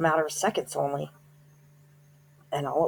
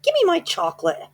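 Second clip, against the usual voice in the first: put-on much higher, louder bratty boy; whiny boy demand; 6 dB louder than usual. Bruno whining to the witch for his chocolate, high and bratty. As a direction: demand it like a spoiled kid.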